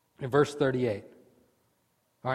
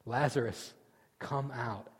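soft command; 3 semitones lower, 8 dB quieter than usual. Acted. Quiet retelling of the command.

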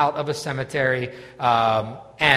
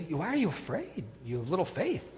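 much softer, kindly rebuke; gentle rebuke; 10 dB quieter than usual. Retold or imitated. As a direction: ask it gently, not harsh. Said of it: "I don't think Jesus is being too hard".

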